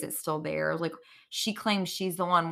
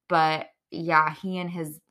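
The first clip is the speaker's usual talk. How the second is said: usual speaking voice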